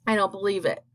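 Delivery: ordinary delivery, nothing marked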